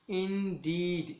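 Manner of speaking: Indeed very slowly, with a long डीड; teacher's drawn-out Indeed, weight on डीड; stress on second syllable. Shown as a demonstration. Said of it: "stress दिया जा रहा है D double E D".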